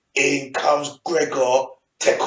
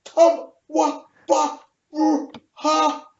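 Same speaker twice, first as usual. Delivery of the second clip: high, slow, stuttering disbelief; re-enacted speechless shock; 11 semitones higher, 4 dB louder, slower than usual. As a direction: break into high stray syllables, lost for words. His own stunned reaction, re-enacted.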